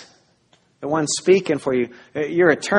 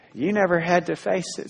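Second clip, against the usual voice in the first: ordinary talk, nothing marked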